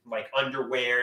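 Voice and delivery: normal talking voice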